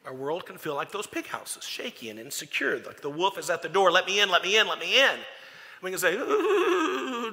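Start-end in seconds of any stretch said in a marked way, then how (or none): 3.9-5.2 louder, pushy wolf's demand; wolf demanding entry. Acted. Wolf at the door demands to be let in, loud. 6.2-7.3 mock ooh. Mock reply to the wolf.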